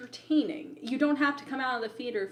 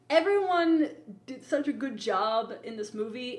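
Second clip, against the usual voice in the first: usual speaking voice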